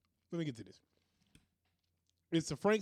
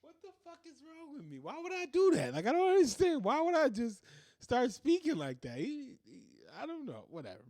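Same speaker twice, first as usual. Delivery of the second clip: laughing at himself; 9 semitones higher than usual. Real, not acted. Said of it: "laughing at himself".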